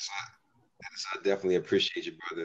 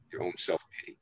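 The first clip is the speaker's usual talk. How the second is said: ordinary delivery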